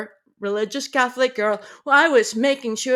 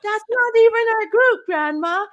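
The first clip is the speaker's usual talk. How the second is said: shocked protest; 9 semitones higher than usual. Retold or imitated. Shocked teen scolding grandma.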